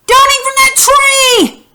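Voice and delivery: very high, loud, slow shout; exclamation command. Shown as a demonstration. Teacher contrasting sentence types for pupils, high shouted exclamation. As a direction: shout it high as an urgent order. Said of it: "difference in my voice and how I read the sentences".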